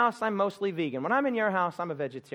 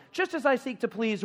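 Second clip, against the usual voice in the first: higher, eager Just as I seek to please; more enthusiasm; 7 semitones higher than usual. Shown as a demonstration. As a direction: pump up the crowd. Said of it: "you didn't say that with enough enthusiasm".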